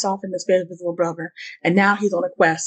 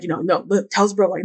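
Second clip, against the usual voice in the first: ordinary delivery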